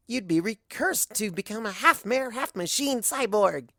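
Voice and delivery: high, bouncy chatter, lightly voiced; excited Pinky voice. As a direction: ramble on, thrilled by the idea.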